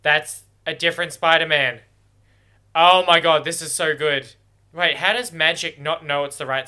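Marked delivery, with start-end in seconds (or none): 2.8-4.3 loud delighted outburst; much louder gasp of joy at the screen; thrilled. Real, not acted. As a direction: fan losing it over a surprise. Garfield Spider-Man appears.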